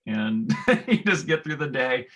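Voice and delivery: real chuckle breaking into speech; laughing. Real, not acted. Teacher recalling a difficult student to fellow educators, laughing.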